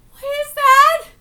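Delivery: ordinary delivery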